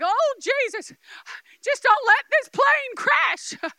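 high, drawn-out mock panic; panicked prayer. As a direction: act out a frightened plea to Jesus. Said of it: "and they're scared".

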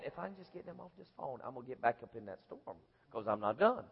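inner grumbling. Retold or imitated. Inner thoughts during phone call.

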